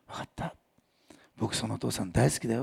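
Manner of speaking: put-on boy quietly telling the teacher he loves God; soft child's reply. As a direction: answer shyly like a small boy. Child answers softly.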